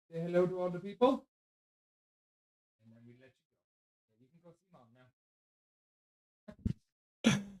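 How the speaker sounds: tender doggy talk, trailing into murmurs; cooing to the dog. Real, not acted. Cooing and fussing over his dog.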